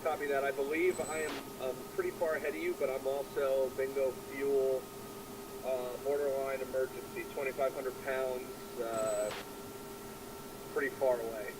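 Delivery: muffled mask mic radio; through oxygen mask radio. Real, not acted. Wingman radio call, flight sim.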